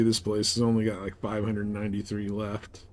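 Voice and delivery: ordinary delivery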